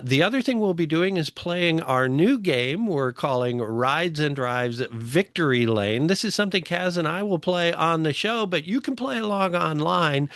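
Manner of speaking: ordinary talk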